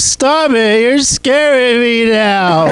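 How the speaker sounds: high, slow frightened whine; scared roommate. Retold or imitated. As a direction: back away in a high squeak.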